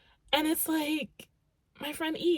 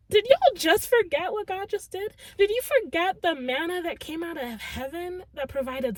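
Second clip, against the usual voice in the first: loud, higher-pitched scolding disbelief; incredulous rebuke; 5 semitones higher, 8 dB louder than usual. Acted. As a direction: demand how they could forget. Acted rebuke of forgetful doubters.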